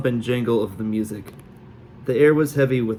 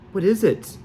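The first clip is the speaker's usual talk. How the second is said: put-on eager girl; 9 semitones higher than usual. A narrator's reading. Girl seizes his arm, eager.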